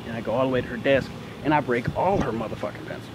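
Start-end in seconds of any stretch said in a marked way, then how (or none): none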